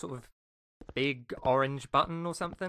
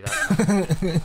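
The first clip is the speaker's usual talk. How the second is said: loud taunt; 4 semitones higher, 13 dB louder than usual. Acted. Game villain taunting player.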